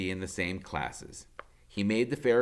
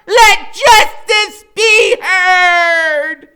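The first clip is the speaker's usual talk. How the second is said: very high, loud, slow proclamation; booming courtroom yell; shouting; 21 dB louder, much slower than usual. A narrator's reading. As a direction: bellow like a judge banging the gavel. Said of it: "he starts shouting".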